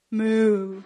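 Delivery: low deadpan moo; mock cow. Wife answering her husband's scolding, mooing. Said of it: "I'll just look at him and I'll say".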